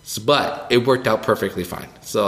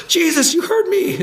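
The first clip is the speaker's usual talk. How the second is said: high, delighted squeal; thrilled kid crying out to Jesus; excited Simon. Acted. Simon sees Jesus bring gifts, squealing high.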